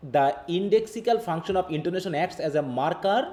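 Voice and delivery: reads each English word out slowly; careful, slow read-aloud; slow textbook reading. Acted. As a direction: dictate it word by word to the class.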